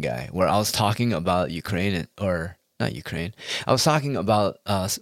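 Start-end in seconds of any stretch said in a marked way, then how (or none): none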